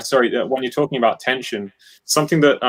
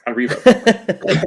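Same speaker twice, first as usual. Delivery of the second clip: laughing; 5 semitones higher, 3 dB louder, much slower than usual. Real, not acted. Joking punchline, laughing.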